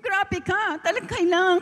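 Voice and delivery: high, choked plea to the Lord; tearful prayer. Retold or imitated. Crying to the Lord in the elevator after the cheque, tearful high plea. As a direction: pray through tears, voice lifted high. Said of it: "iyak ako ng iyak".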